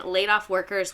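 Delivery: ordinary delivery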